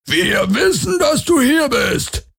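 very high, slow creature shout; high drawn-out hunter's cry; distant menacing call. Acted. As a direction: screech it out slowly in a piercing alien voice.

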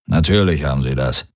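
low grumble under the breath; low grumbling warlord muttering to himself; grumbled to himself. A narrator's reading. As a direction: mutter it darkly into your chest. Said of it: "brummte Darayos in sich hinein".

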